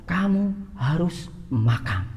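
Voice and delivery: high, slow, insistent "Kamu harus makan"; loving parent insisting. Retold or imitated. Parent insisting a hungry child eat, high and slow. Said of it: "Intonasinya itu juga beda".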